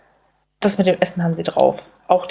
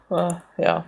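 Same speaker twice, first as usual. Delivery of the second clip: ordinary delivery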